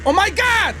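high, loud shocked cry. Real, not acted. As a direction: blurt it out in shock. Shocked exclamation.

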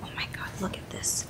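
hushed whisper in public. Real, not acted. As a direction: whisper it so nobody around hears. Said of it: "Usually I'm whispering out in public".

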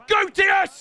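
high yell at a player; urgent shout. Real, not acted. Gamer urging Diaz on, urgent shout. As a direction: yell him forward, high.

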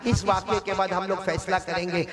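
ordinary talk

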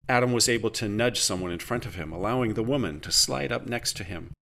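ordinary delivery

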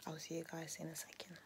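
whisper. Real, not acted. Whispered sign-off.

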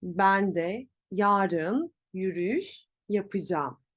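yapacağım as ya-pı-jam; spoken contraction. Shown as a demonstration. Teacher gives a sample answer, yapacağım as yapıcam.

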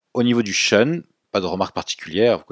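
normal talking voice

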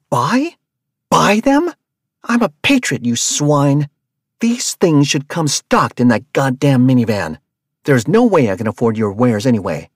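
loud, high, incredulous fury; shouting down the van master; outraged yelling. Acted. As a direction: scoff then rage at him. Rabia outraged at buying supplies.

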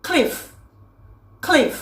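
"cliff" said alone, ending on a clear f; "cliff" as a careful, isolated example word; model pronunciation. Shown as a demonstration. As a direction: model "cliff" for learners, falling tone. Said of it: "อย่างเช่นคำว่า".

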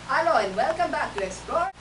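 put-on Indian-accent presenter; practised Indian accent. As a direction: rehearse a show opening in an Indian accent. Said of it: "I have to practice my accent".

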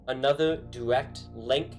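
normal talking voice

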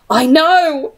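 lightly voiced wailing Henry; plaintive little wail, hint of panic; mild wail. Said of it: "Henry wailed".

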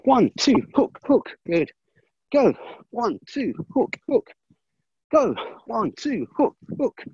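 sharp, punchy count calls; boxing coach calling combos. Acted.

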